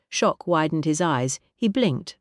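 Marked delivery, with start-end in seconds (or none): none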